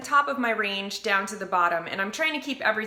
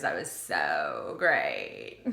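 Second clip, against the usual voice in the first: vocal fry; 4 dB quieter, much slower than usual. Acted. Praising after a vocal fry exercise.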